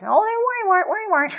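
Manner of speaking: high, sing-out nickname call with a laugh; mock teasing in a high voice; teasing nanny. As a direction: imitate a nanny teasing a child, high-pitched. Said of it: "She used to call me Worrywart".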